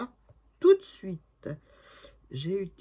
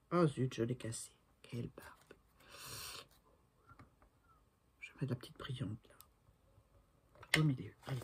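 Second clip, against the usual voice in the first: whispered grumble under her breath; muttering to herself; 4 semitones lower, 6 dB quieter than usual. Real, not acted. Crafter mutters to herself after breaking a piece, whispering. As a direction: grumble it to yourself, barely voiced.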